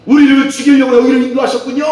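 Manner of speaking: loud, high-pitched acted complaint. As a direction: cry out in mock accusation. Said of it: "백성들이 하나님께 원망한 적이 있어요". (원망하듯 높고 크게)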